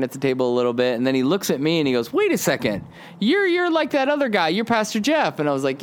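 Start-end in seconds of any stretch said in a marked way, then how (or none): 2.1-5.4 high, surprised stranger's voice; stranger recognizing him. Retold or imitated. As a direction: act the stranger suddenly placing him, much higher.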